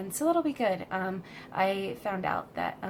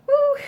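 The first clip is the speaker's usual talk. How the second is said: high, loud startled whoop; excited whoop; 16 dB louder than usual. Real, not acted. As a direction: let out a sudden shrieking woo. Cat squirms in her arms.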